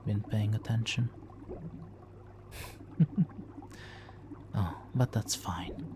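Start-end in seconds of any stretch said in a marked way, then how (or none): 3.0-3.6 a short, pleased titter. Acted. Villain amused listener isn't paying attention.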